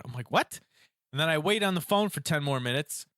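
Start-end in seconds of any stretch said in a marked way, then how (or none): 0.3-0.6 higher-pitched, mock-baffled "what"; incredulous reaction. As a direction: blurt it like a retold double take. Retold disbelief at transfer.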